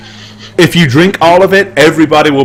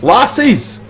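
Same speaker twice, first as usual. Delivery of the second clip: put-on Scottish accent; 10 semitones higher, much slower than usual. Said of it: "He says".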